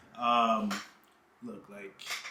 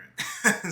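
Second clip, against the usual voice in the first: short breathy laugh at own joke; quick chuckle after the joke; 7 semitones higher, 5 dB louder than usual. Real, not acted. Joking about quitting his job.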